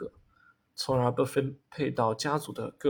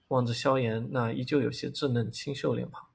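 plain reading voice

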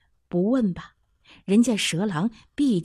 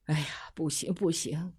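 put-on whispered, softer old man's refusal; 5 semitones lower, 10 dB quieter than usual. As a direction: breathe it out, shaking your head. Father refuses, shaking his head.

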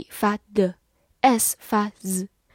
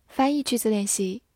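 normal talking voice

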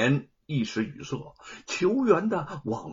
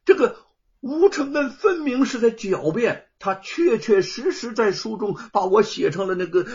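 louder and higher, insistent; loud protest; 6 semitones higher, 6 dB louder than usual. Acted. Plaintiff loudly insists before the judge, shouting. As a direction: shout your complaint at the judge. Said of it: "大声地说".

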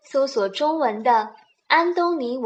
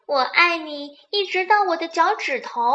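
higher-pitched little rabbit voice; 小兔子; 6 semitones higher than usual. A narrator's reading. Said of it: "小兔子又有了一个好主意". (小兔子的高音调)

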